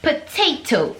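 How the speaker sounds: "potato" with crisp T's, "poteto", slow; British pronunciation. Shown as a demonstration. Contrasting with the American version, in a British accent. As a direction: say "potato" the English way, both T's sharp. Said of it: "Mais, mais ici, ils vont dire".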